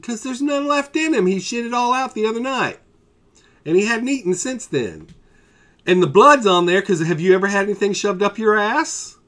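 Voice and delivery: high, loud, outraged telling-off; indignant rant. Retold or imitated. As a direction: pile on the outrage at the careless vet. Owner ranting at the vet.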